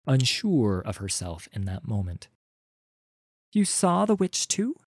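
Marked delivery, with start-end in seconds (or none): 3.5-4.8 put-on much higher, wry girl's voice; a sceptical young woman asking; young Wreath. Wreath asks Shamble.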